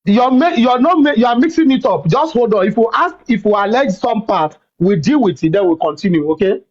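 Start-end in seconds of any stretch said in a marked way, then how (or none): none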